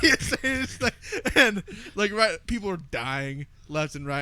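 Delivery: usual speaking voice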